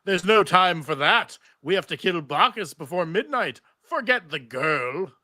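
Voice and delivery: slow, heavy voice of Orpheus's severed head; slow ominous head. Acted. Narrator voicing Orpheus to Xena, slow and ominous. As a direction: drag each word out like a scheming head.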